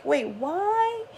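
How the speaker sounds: high, bewildered double take; sudden confusion at herself; mock shocked disbelief; surprised. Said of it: "And I'm like".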